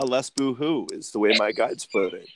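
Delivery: usual speaking voice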